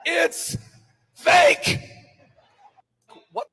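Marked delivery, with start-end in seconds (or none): 0.1-1.8 mock exasperation. Mocking critics of the movie.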